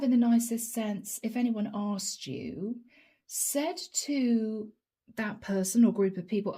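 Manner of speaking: ordinary talk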